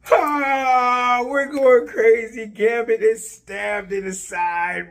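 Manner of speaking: high, loud, drawn-out wail of fan shock; hyped-up screaming. Retold or imitated. Fan reliving Gambit getting stabbed, wailing in hyped-up shock. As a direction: cry it out way up high, stretching the words.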